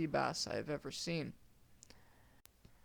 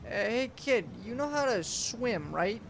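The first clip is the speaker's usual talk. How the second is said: raised, higher uncle voice calling over the wind; piping up; 6 semitones higher, 5 dB louder, slower than usual. A narrator's reading. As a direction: call it over the waves. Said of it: "Matt pipes up".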